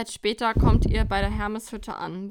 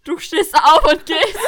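loud and higher, laughing through it; 7 semitones higher, 14 dB louder, slower than usual. Real, not acted. Reading the cheeky quiz answer to her friend, laughing. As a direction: barely hold it together.

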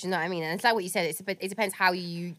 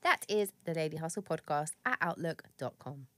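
sultry low voice; 5 semitones lower, 6 dB quieter than usual. Acted. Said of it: "her tone of voice dropping a couple levels".

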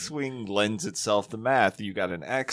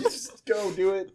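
laughing; 8 semitones higher, 4 dB quieter, slower than usual. Real, not acted. Group in fits of laughter.

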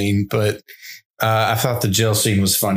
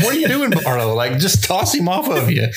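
high, incredulous mock voice; a hammed-up high exasperated question; mock exasperated onlooker; 6 semitones higher than usual. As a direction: scold the vampire like a baffled fan. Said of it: "He's like".

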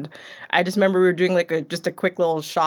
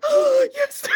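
delighted squeal. Retold or imitated. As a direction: shriek like you spotted your favourite character. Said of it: "I'm like".

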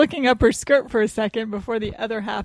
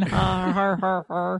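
sarcastic fake laugh; 3 semitones lower, much slower than usual.

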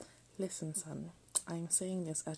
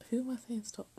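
higher, louder amused question; amused self-surprise; 6 semitones higher, 4 dB louder than usual. Real, not acted. Reader laughing at herself for saying stop: higher, louder amused question. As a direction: catch yourself talking to nobody.

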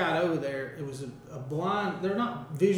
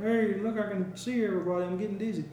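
talking eyeball; 3 semitones higher than usual. Pretend. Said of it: "That's your eyeball voice?".

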